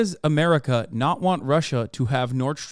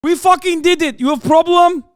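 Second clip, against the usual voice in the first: put-on very high, loud gloating shout in a Polish accent; 12 semitones higher, 9 dB louder than usual. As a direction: yell it like a triumphant Polish chatter. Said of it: "Polish chatters in here being like".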